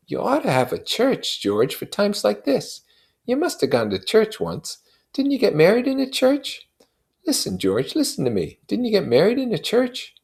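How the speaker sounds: high, pleading friend's voice pitched well up; Michaelis's voice. A narrator's reading. Neighbor pressing grieving Wilson for an answer, high and urgent.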